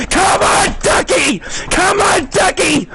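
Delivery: high excited rooting call; cheering. Real, not acted. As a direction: yell him home to the win. Rooting for the leader.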